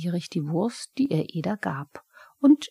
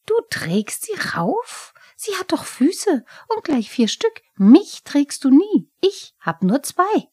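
much higher, louder jealous kobold whine; a goblin sulking that the cat gets carried; Pumuckl the kobold, jealous; 10 semitones higher, 9 dB louder than usual. Acted. Pumuckl ist eifersüchtig.

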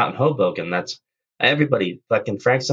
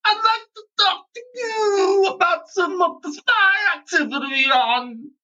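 put-on high, slow mumbling old man; mock Cosby rambling into a radio; much higher, slow drawling impression; Bill Cosby impression; slower than usual. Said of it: "radio back and be like".

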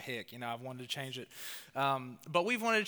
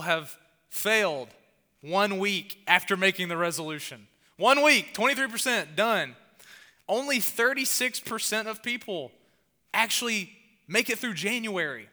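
louder and higher, hammering each number; emphatic preaching; 5 semitones higher, 7 dB louder than usual. Acted. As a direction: drive the statistics home with force. Preacher hammering shocking statistics.

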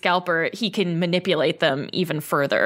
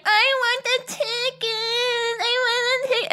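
put-on whiny kid. Kid begging the parent for a ticket, high singsong whine. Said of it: "the kid is like".

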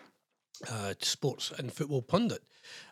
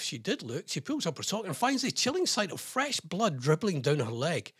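ordinary talk, nothing marked

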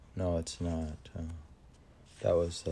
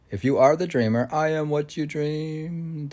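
higher, louder, fast and fervent; grand declamation; 10 semitones higher, 7 dB louder than usual. Acted. Host playfully rereads the line to listeners, grandly declaimed. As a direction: proclaim it like a stage oracle.

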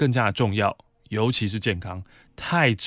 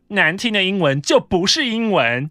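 mock snobby coworker; 7 semitones higher, 5 dB louder than usual.

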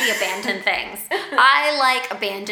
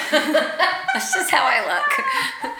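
laughing it off; giggling through it; 8 semitones higher, much slower than usual. Real, not acted. Laughing at own sneeze.

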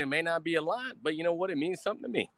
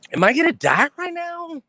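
hammed-up terrified cry, higher and louder; mock terror; 10 semitones higher, 7 dB louder than usual. Streamer mocks a threatening arm wrestling champ in hammed-up terror. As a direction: overplay being scared to death.

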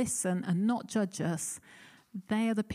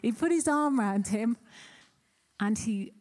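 choked up. Real, not acted. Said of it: "I'm gonna cry too now".

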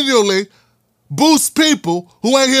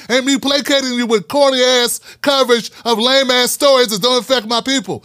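ordinary talk, nothing marked

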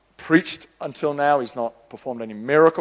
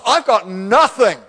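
widow's helpless reply; 8 semitones higher, 4 dB louder, slower than usual. Acted. Widow voiced answering prophet.

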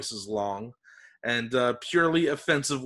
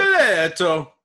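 loud, higher hammed-up Italian exclamation; hammy Italian accent; 6 semitones higher, 8 dB louder, slower than usual. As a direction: shout it like a stage Italian.

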